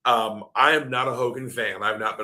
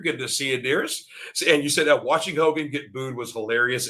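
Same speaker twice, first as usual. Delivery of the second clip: ordinary talk, nothing marked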